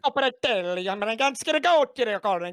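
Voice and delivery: high, loud fake Italian. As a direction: ham up Italian nonsense. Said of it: "and the Italian".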